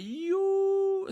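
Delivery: high, drawn-out exclamation; mock shock. Patient hearing the dentist's prices, drawn-out mock shock. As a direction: stretch one word out high in mock disbelief.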